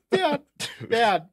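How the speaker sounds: high, loud retold scolding; acting out pleading with dad to stop; exasperated. Son scolding dad in retelling.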